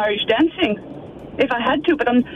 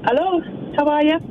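Irish-accented hello; 2 semitones higher than usual. Real, not acted. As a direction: answer the phone with an Irish lilt. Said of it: "Jer, you've got an accent now".

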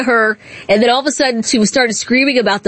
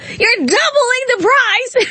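mock reporter yell; 11 semitones higher, slower than usual. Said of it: "She just yells at him".

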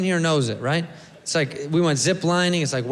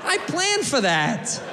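defiant tourist; 3 semitones higher than usual. Retold or imitated. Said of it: "I go".

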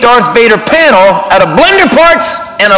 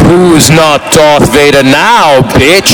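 much lower slow Vader voice; menacing Darth Vader; 7 semitones lower, much slower than usual. Acted. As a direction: loom over him in character. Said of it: "I was in character".

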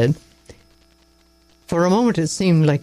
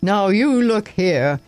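slow, higher-pitched bluster; 4 semitones higher, slower than usual. A narrator's reading. An insulted father blustering back at the doctor, slow and high. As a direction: puff up and sputter back. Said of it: "he started to bluster".

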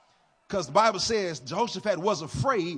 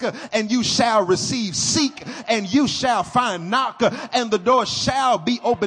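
loud, rising, whooping sermon; preaching at full force; 3 semitones higher, 10 dB louder than usual. Acted. Pastor rousing the congregation to believe in prayer, loud. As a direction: build the church up to a roar.

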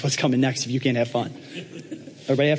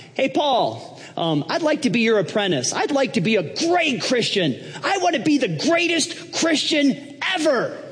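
high-pitched, eager would-be apprentice; Donald Trump; 11 semitones higher than usual. Acted. Trump asking Paul to be his apprentice, high and eager. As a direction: pitch it high, building to a drawn-out boast. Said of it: "he walked in one day and said".

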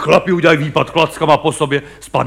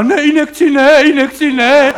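high pitched, louder mock protest; mock whiny refusal; 8 semitones higher, 4 dB louder, slower than usual. Comedian mimics the fainted actor refusing the doctor, high and whiny. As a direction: wail it like a fainting tough guy.

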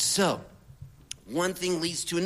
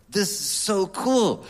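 excited reaction; 4 semitones higher, 5 dB louder, slower than usual. Retold or imitated. Elated reaction to the phone message.

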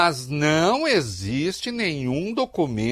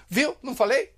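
higher-pitched echo of a boast; mock I-told-you-so; 8 semitones higher than usual. Commentator imitating a second post, mock I-told-you-so. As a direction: imitate a follower claiming vindication. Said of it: "também publica dizendo".

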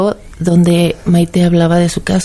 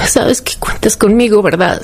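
tender whisper; 3 semitones higher than usual. Retold or imitated. Mother reassuring her daughter far from home, in a tender whisper. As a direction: whisper it gently, like a promise.

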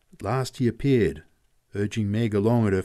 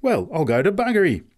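high, loud amazed oath; flabbergasted, raised voice; exclaiming; 9 semitones higher, 6 dB louder than usual. A narrator's reading. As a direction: swear in sheer disbelief. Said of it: "exclaimed the man".

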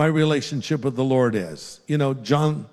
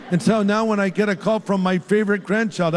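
ordinary delivery

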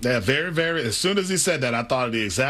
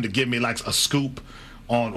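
normal talking voice

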